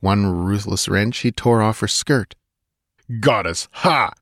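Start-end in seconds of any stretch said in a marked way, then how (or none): 3.2-4.1 contemptuous scoff; angry contempt. A narrator's reading. Conan scoffing at the false goddess, angry contempt. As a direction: bark it with scorn. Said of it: "His bark was full of angry contempt".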